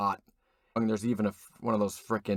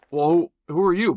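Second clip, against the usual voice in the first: suddenly loud and higher, calling out; loud challenge; 7 semitones higher, 9 dB louder than usual. Acted. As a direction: shout the question at the stranger. Roadside stranger, acted challenge.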